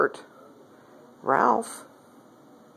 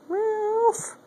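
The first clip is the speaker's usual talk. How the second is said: squeaky very high call of the cat's name; high-pitched name call to a pet. Real, not acted. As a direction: call him in a tiny high voice. Calling the cat.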